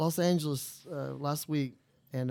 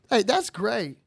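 normal talking voice

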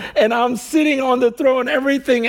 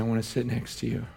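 tender hushed quote; 11 semitones lower, 12 dB quieter, much faster than usual. Retold or imitated. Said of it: "is saying".

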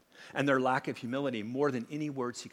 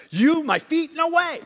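high, loud disbelieving outburst; emphatic protest; 14 semitones higher, 10 dB louder, slower than usual. Retold or imitated. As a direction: Peter refusing, shocked and loud. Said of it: "it's more emphatic".

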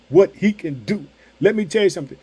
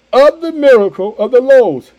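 higher, louder preaching shout; preaching cry; 6 semitones higher, 8 dB louder than usual. Real, not acted. Preacher building the sermon, crying out the line loud and high. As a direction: ring it out to the church.

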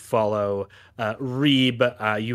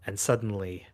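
dramatic narration; 5 dB quieter than usual. Acted. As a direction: draw them into the dark. GM narrates blackout scene.

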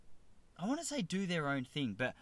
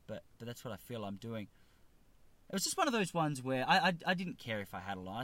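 ordinary talk, nothing marked

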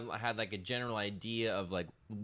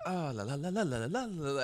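rising, higher gibberish rant; mock upset rant; 6 semitones higher than usual. Imitating Ricky Ricardo's upset Spanish rant, higher. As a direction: fake an upset Ricky rant in nonsense syllables. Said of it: "when he's, like, upset".

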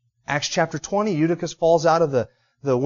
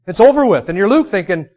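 raised, louder impassioned preaching; preaching at full force; 7 semitones higher, 6 dB louder than usual. Acted. Preacher telling how Paul outdid Doctor Luke, in full fervour. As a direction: drive the story home to the congregation, higher and louder.

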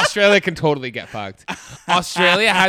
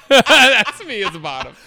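laughing through the words, higher and louder; 6 semitones higher, 7 dB louder than usual. Real, not acted. Podcaster repeating own joke about bottoming, laughing. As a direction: crack up as you repeat it.

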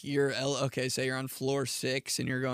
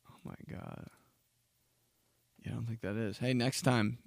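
ordinary delivery

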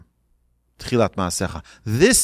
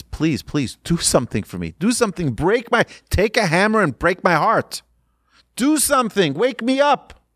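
acted plea climbing much higher in pitch; pleading; 6 semitones higher than usual. Man begs his Rebbe to break his stony heart, pleading. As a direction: imitate a man begging, voice rising as it goes. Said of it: "he starts pleading with the Rebbe".

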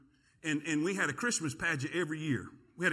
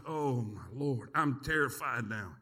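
mock dread; 7 semitones lower, slower than usual. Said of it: "I'm like".

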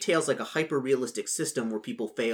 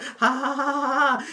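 mock laugh; 12 semitones higher, 4 dB louder, slower than usual. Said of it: "He's not gonna be like".

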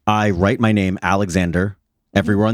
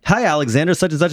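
fake friendly greeting; 8 semitones higher than usual. Mimics spam email greeting.

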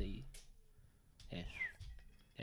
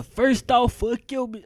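high shouted cussing, much louder; mock angry rant; 13 semitones higher, 9 dB louder, slower than usual. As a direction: yell the cuss words like a radio-free rap line. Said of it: "I need to hear".

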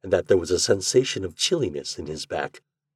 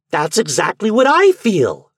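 high, loud, excited outburst; ever-growing excitement; 12 semitones higher, 8 dB louder than usual. A narrator's reading. Garvey agreeing with the secretary, more and more excited. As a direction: seize on it excitedly, voice shooting up. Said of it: "with ever-growing excitement".

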